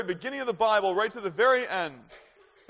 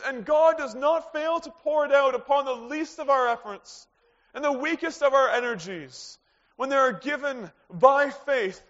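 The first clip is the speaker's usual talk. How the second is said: preaching at full force; 5 semitones higher, 5 dB louder than usual. Acted. Preacher driving home God's loyalty to the congregation, full force. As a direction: press the point home to the congregation.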